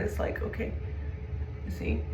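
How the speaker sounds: hushed, secretive aside; whispered aside. Real, not acted. Tarot reader to viewers, noticing a dragonfly, whispered.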